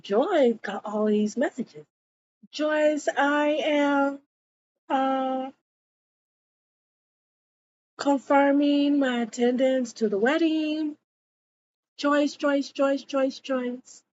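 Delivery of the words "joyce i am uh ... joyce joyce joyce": higher-pitched, lightly voiced caller; phone message voices. Callers confirming attendance at Joyce's wedding, lightly put-on message voice.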